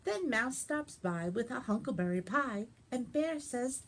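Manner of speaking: put-on lively read-aloud for children; animated picture-book reading; story narration. A narrator's reading. Librarian reads picture book.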